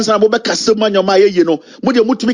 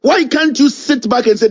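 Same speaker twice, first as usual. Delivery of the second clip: normal talking voice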